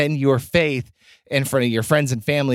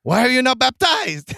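put-on high Mexican-accented wrestler voice; Nacho Libre voice; 10 semitones higher than usual. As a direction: channel the goofy friar. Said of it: "Nacho goes up to Esqueleto and goes".

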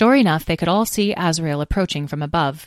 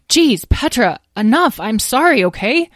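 higher, louder defensive plea; exasperated protest; 7 semitones higher, 7 dB louder than usual. Acted. Azriel shielding from a sister, exasperated apology. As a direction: fend off an attack with an apology. Azriel fends off Petra's attack.

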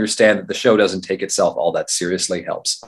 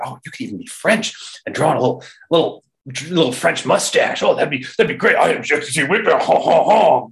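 dad's voice; 6 semitones higher than usual. Retold or imitated. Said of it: "my dad just kinda threw out, he was like".

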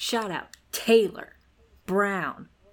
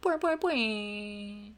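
springy boing noises; bouncy springy boingy voice; 3 dB quieter, slower than usual. Pretend. Boing sounds.

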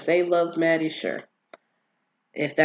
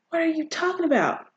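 high, quick incredulous quote; mock bafflement; 12 semitones higher, faster than usual. Vlogger acting out Nate answering Cassie's rant, mock bafflement. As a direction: pitch it way up, as if totally baffled.

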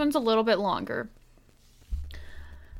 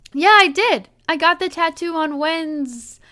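higher, louder acted husband; emphatic fake admission; 6 semitones higher, 5 dB louder than usual. A narrator's reading. Husband doubling down on prank to wife, high emphatic admission. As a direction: insist on it brightly and firmly.

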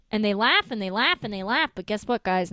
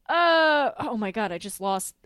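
ordinary talk, nothing marked

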